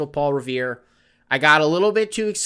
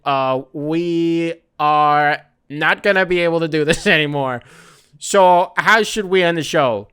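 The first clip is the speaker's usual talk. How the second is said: louder hammed-up writer pleading with the boss; mock TV writer; 6 dB louder than usual. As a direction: play a sheepish writer, loud and mocking. Mocking show writers asking boss.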